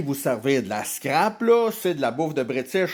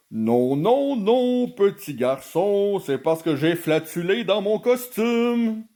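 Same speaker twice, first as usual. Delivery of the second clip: higher, hammed-up jolly mascot voice; bonhomme Carnaval; 5 semitones higher than usual. Bonhomme Carnaval explaining himself to the little boy, hammed-up mascot voice. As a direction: protest cheerfully, drawing out the noes. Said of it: "le bonhomme".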